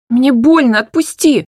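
high loud cry of pain. Acted. Hanna crying out as her father grips her wrist, loud and high. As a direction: cry out to be let go.

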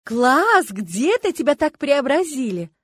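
loud, high, drawn-out amazement; admiring gasp. A narrator's reading. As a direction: gush over a friend's new look, slowly and loudly. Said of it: "подруга ахнула". (восхищённо ахая, громко и протяжно)

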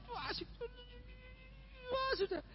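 put-on very high, soft, whiny child delight; excited child squeal. Child playing gamebot, soft high squeal of delight. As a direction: squeal softly like a kid hooked on the game.

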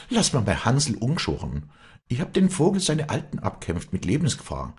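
high, insistent plea; impassioned pleading. Acted. Walli begs Josef to spare her Hansl, impassioned plea. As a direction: plead for the one thing you love.

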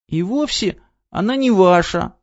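выше, детский голосок с намёком на слёзы; обиженно, чуть не плача; сквозь слёзы. A narrator's reading. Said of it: "сквозь слёзы пробормотала девочка". (девочка сквозь слёзы спорит с Крыловым)

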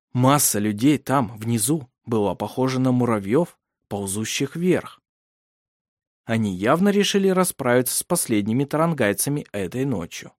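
ordinary delivery, nothing marked

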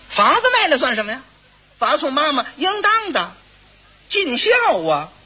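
high-pitched, self-righteous protest; proud dismissal. Acted. Second son answers a neighbour about selling his house, proud dismissal. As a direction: wave it off as simple filial duty. Son brushes off cost of funeral.

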